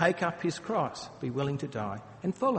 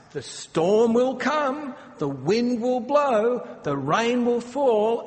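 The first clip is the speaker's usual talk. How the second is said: dramatic build; 8 semitones higher, 7 dB louder, slower than usual. Acted. Dramatic quote of storm.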